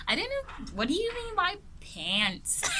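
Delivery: high, put-on American accent, puzzled; mock an American asking about pants. Teacher mocks a puzzled American in a high American accent. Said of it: "I can't really do the American accent".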